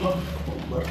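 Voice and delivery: breathless whisper under effort; whispered prayer. Real, not acted. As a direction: murmur it while crawling in. Squeezing in through narrow gap. (ফিসফিস করে দোয়া)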